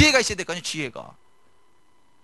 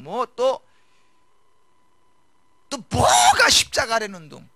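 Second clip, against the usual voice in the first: louder and higher, building to a shout; preaching at full force; 6 semitones higher, 8 dB louder than usual. Acted. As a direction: rail against the excuses, slowing at the peak. Preacher rebukes complaining believers.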